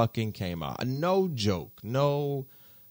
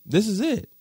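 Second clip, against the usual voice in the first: blissful; 8 semitones higher than usual. Retold or imitated. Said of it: "I'm like".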